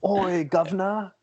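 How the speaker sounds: high, hammed-up Cockney "Oi, governor!"; mock Cockney. Joining in mocking the British gamer, hammed-up high Cockney. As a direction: play a cartoon London cabbie. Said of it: "there was, like, a British person".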